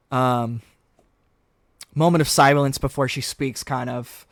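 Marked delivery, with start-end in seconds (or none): none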